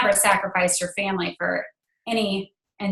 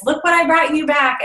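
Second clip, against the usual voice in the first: put-on higher, bright announcing to the kids; excited mom; 7 semitones higher, 3 dB louder than usual. Mom handing gifts to her kids, excited. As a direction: show off the presents with delight.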